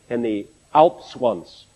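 usual speaking voice

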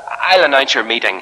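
ordinary delivery

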